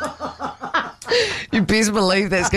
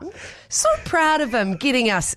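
ordinary delivery

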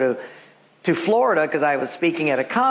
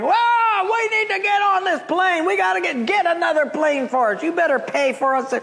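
much higher, strained mock yell; mock crowd yelling; 12 semitones higher than usual. Two hundred passengers yelling at customer service, mock shouting. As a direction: ham up the furious queue. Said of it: "are yelling".

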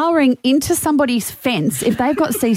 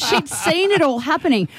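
usual speaking voice

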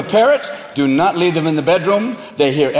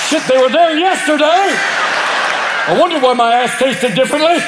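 shocked dog; 6 semitones higher than usual. Acted. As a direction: wail like a dog making an awful discovery. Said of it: "a cat and a dog".

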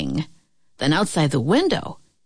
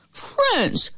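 crying out; 13 semitones higher than usual. A narrator's reading. Wolf cries out as he starts to slip, very high exclamation. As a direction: cry it out high, then fall. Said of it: "cried the wolf".

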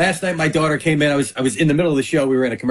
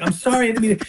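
lightly imitated little girl, higher; child's apology; 7 semitones higher, faster than usual. Father imitating his five-year-old apologising, higher voice. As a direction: apologise in a raised small-child voice.

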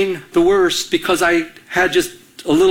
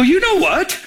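confrontational; 12 semitones higher than usual. Retold or imitated. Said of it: "I confronted her right away".

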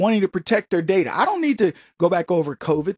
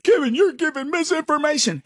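higher, mocking accuser voice; mock critic; 6 semitones higher than usual. Host mimicking letter writers accusing him, in a mocking voice. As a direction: sneer it back as the accusers did. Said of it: "wrote to me and told".

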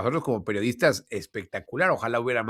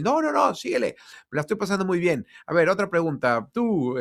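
much higher, hammed-up carefree boss; lightly mocking impression of a smug president; mock Florentino; 6 semitones higher than usual. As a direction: play the president loving the spotlight. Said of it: "Y él".

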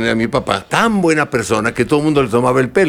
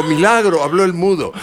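father's joke; 7 semitones higher than usual. Retold or imitated. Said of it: "mi papá me levantó y dijo".

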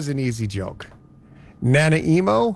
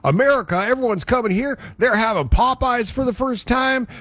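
excited friend; 8 semitones higher than usual. Retold or imitated. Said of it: "and he's like".

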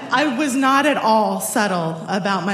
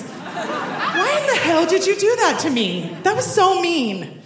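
mock indignant; 9 semitones higher than usual. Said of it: "And I asked him".

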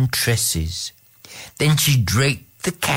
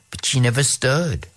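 ordinary delivery

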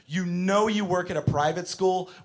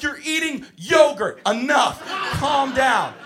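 exasperated teacher; 9 semitones higher, 4 dB louder, slower than usual. Retold or imitated. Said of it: "I'm like".

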